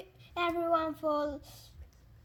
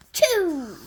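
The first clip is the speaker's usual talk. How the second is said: loud sudden sneeze-like burst; sneeze wind-up; 4 semitones higher, 12 dB louder than usual. Acted. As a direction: blurt it like a sneeze. Sneeze attempt.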